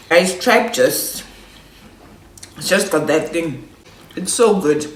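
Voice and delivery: talking through a mouthful; words round a bite of food; mouth full. Real, not acted. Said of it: "I can't talk while I eat".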